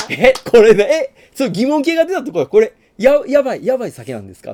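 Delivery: high and loud, half shouting; loud question. Retold or imitated. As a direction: shout it over the drinking noise. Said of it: "って言ったら".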